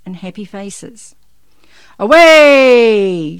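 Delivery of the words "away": very loud, long-held call. A narrator's reading. Santa sends the reindeer off, calling loudly. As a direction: holler it out to the reindeer. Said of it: "Santa called".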